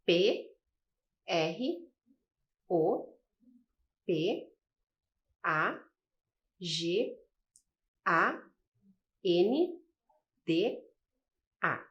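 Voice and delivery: each letter said alone with a pause; slow letter-by-letter spelling for a class. Shown as a demonstration. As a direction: spell it out one letter at a time. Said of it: "compostas pelas letras".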